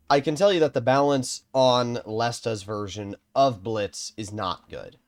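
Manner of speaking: normal talking voice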